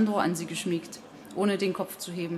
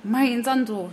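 high, raised cry of a name; higher emotional call; woman's cry; 5 semitones higher, 4 dB louder, slower than usual. A narrator's reading. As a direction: cry out to the boy. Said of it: "schrie die Frau".

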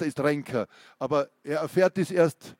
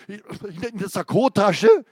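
loud, higher, tripping over the start; breathless prisoner jeering at interrogator; stammering outburst; 6 semitones higher, 6 dB louder than usual. Acted. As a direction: stammer then shout the question. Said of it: "Er stotterte, schnappte nach Luft".

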